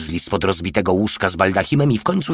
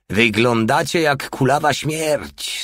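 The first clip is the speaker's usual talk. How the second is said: slow, higher-pitched jibe; amused teasing; 6 semitones higher, slower than usual. A narrator's reading. Porta joking with Chinese sentries, lightly amused. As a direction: tease them with a touch of laughter. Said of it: "stwierdził ze śmiechem Porta".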